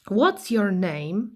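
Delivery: very slow, clear English for the class; teacher modelling a question; slow model phrase. Shown as a demonstration. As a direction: spell it out word by word for beginners.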